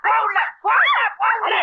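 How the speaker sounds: high, sharp shouted command; angry order. Acted. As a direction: drive her back inside. Ordering her inside angrily. (கோபமாக உள்ளே போ என்று கத்துதல்)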